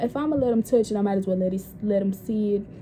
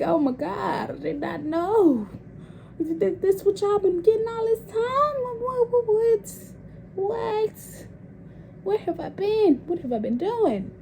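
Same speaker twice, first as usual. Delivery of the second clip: much higher, giddy, half-laughing amazement; squealing disbelief; 11 semitones higher than usual. Retold or imitated. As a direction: play her own shocked delight. Retelling her own disbelief.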